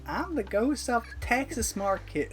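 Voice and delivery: put-on Texas accent. Friend plays a ghost character, Texas accent. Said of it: "it was like a Texas kind of accent".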